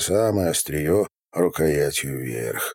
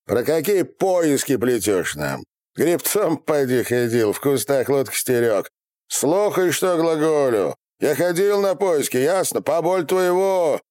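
browbeating; 8 semitones higher than usual. Acted. Interrogator browbeats the captive.

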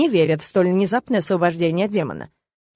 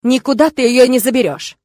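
loud, curt refusal; firm and unyielding, voice raised; sharp, hard tone; 3 semitones higher, 7 dB louder than usual. A narrator's reading. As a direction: shut him down harshly. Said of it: "отрезал он резким, жёстким тоном".

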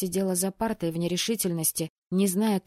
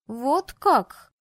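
thoughtful drawl; 6 semitones higher, 4 dB louder than usual. A narrator's reading. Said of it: "задумчиво протянула девушка".

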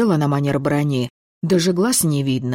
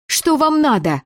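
put-on a girl whose voice is shredded demands answers, croaking it; hoarse croak; 12 semitones higher, 3 dB louder than usual. A narrator's reading. Хриплым сорванным голосом. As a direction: rasp it out high and hoarse, voice torn from screaming. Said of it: "прохрипела".

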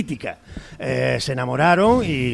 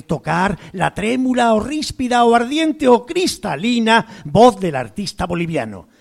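animated, higher-pitched novel reading; literary reading aloud; 3 semitones higher, 3 dB louder than usual. A narrator's reading. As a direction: read the page out with relish and strong emphasis. Lee en voz alta una página de novela. (lectura literaria en voz alta, expresiva y enfática)

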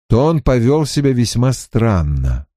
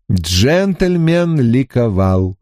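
ordinary delivery

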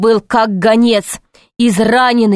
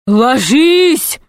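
urgent warning cry, raised in pitch; mildly shouted command, pitched up; terrible-voiced shout; 4 semitones higher than usual. A narrator's reading. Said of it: "крикнул он страшным голосом". (предостерегающий крик страшным голосом)

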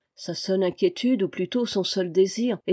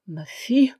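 stammered shock; 4 semitones higher, 4 dB louder than usual. A narrator's reading. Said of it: "balbutia Vaunois".

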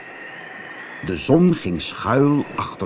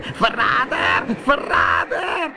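very high, drawn-out witch screech; furious screech; 13 semitones higher, slower than usual. Acted. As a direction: screech the accusation in rage, stretching it out. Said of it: "De zeeheks krijste van woede". (krijsend van woede)

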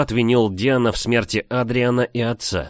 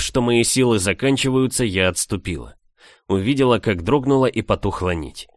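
plain reading voice

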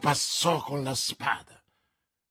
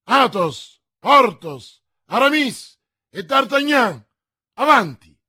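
loud, higher rallying shout; battle cry; 5 semitones higher, 11 dB louder than usual. A narrator's reading. Athos rallying the four musketeers to charge, shouting. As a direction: hurl the names out like a war cry. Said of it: "gridò Athos".

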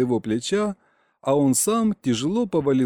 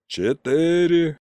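higher, drawn-out count called aloud; out loud; 5 semitones higher, 3 dB louder, much slower than usual. A narrator's reading. Uncle counting for the enemy to hear, out loud. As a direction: call the numbers out, stretching them. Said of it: "а в голос продолжил".

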